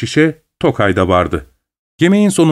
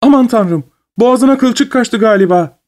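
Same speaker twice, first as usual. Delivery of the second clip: alarmed cry; 11 semitones higher than usual. A narrator's reading. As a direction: shriek that he is choking. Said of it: "diye bağırdı".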